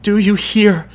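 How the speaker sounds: high, keening wail; a chorus wailing in horror outside the house. A narrator's reading. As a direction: cry it out in grief. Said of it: "the chorus wails".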